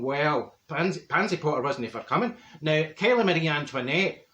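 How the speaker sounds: ordinary talk, nothing marked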